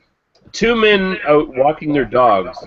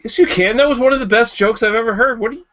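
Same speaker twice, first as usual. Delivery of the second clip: mock outrage; 8 semitones higher, faster than usual.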